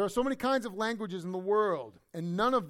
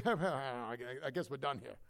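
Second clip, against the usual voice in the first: low, trailing-off mock resignation; softer, lower, half-laughed surrender; acted giving up; 7 semitones lower, 5 dB quieter than usual. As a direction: let it sink down, as if giving up. Acts out giving up on conversation.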